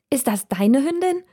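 a lightly voiced girl asking about the dog; cheerful little girl. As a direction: ask happily like a small girl. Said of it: "fragt das Mädchen fröhlich".

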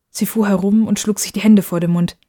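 ordinary delivery

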